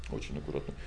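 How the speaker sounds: "Очень аккуратным" dropped low and very soft; hushed repeat. Shown as a demonstration. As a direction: whisper "аккуратным" like a warning.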